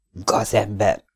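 a touch of quivering outrage; trembling with anger. A narrator's reading. Lee to the blackmailer: mildly trembling anger. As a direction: spit out the insult, shaken. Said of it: "felindultságtól remegő hangon".

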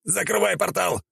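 high, stretched hero cry; hammed-up high-pitched urging; panicked shout. A narrator's reading. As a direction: yell the order in a high, desperate voice. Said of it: "крикнул Святогор".

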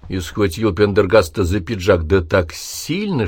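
usual speaking voice

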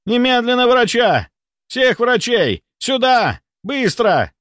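high, urgent shouted orders; thundering yell. A narrator's reading. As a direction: summon everyone down a long corridor. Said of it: "Его голос прокатился по коридору".

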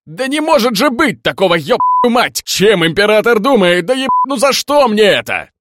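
high, loud, exasperated yelling; furious wailing complaint, shouted; outraged outburst. A narrator's reading. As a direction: bellow it in disbelief at the top of your voice. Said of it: "Я набрал воздух".

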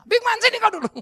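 high loud stern summons; stern aunt. Retold or imitated. Strict teacher aunt summoning naughty nephew, loud stern. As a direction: call the boy in like a strict teacher. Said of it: "dia belok ke rumah".

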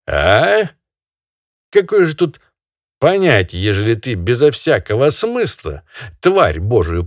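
loud, high lecturing master; scolding barin. Acted. Barin scolding the peasant over the dead bird, loud and high. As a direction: berate him like a pompous landowner.

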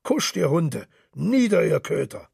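mildly heated, building to a commanding outburst; fired-up speech rising into mock dog-handler commands. A narrator's reading. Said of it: "sagte Mister Henchy hitzig".

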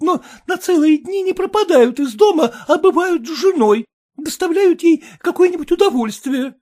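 high, loud, tearful wife; young wife. Acted. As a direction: complain like an upset young bride. Wife's voice.

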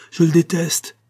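loud, high burst of hatred; vehement. Acted. Monique denying to Marin that she loves him, vehement. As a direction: spit it out with vehemence. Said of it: "avec un retour de son ancienne véhémence".

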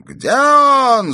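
very high, loud wailing demand; madman's yell. A narrator's reading. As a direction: yell it like an escaped lunatic. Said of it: "завопил Пельт". (истошный вопль безумца)

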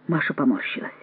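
ordinary delivery, nothing marked

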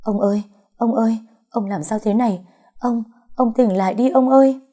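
high, distressed calling out, rising in urgency; panicked cry. A narrator's reading. Granddaughter finding grandfather collapsed, panicked cry. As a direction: plead desperately, pitched well up. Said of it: "vừa chạy vừa gào lên".

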